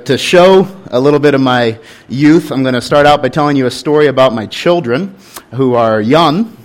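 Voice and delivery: usual speaking voice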